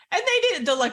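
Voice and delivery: normal talking voice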